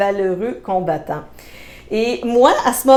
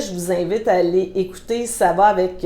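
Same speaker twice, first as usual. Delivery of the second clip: usual speaking voice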